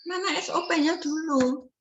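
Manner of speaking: lightly mocking the Dutch nurses asking for the SOP; mock foreign colleague. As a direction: lightly mimic a puzzled foreigner.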